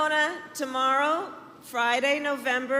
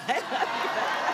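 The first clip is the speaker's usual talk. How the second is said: laughing. Real, not acted. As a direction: laugh it out warmly. Laughing reply to the crowd.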